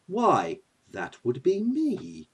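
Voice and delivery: slow, softly spoken grand villain; Loki. Acted. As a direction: announce yourself with quiet self-importance.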